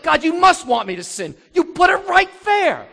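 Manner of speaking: high, loud indignant protest; accusing God. Retold or imitated. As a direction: throw the blame back at God. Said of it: "It's like".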